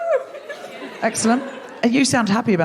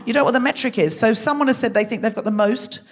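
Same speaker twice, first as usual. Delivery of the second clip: ordinary talk, nothing marked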